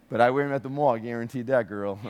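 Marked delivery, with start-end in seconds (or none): none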